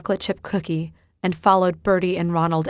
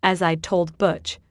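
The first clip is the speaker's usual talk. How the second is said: ordinary delivery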